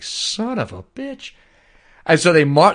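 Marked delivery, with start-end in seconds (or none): 0.0-1.3 high, slow, soft stunned curse; a British general gaping up at the cannons. Retold or imitated. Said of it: "they look up and they're just like".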